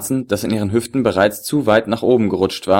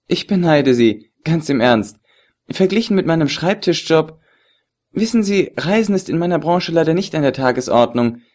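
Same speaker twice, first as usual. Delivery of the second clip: higher, teasing feminine voice; woman's voice; 5 semitones higher than usual. Acted. The beauty teases the agent about his phone call, playfully higher.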